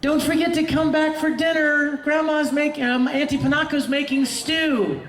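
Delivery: high-pitched calling out after someone; high, carrying dinner call; yelled call. Acted. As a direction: call out in a high girlish holler. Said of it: "Winry yells out".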